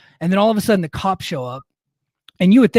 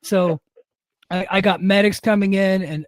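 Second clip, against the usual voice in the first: ordinary talk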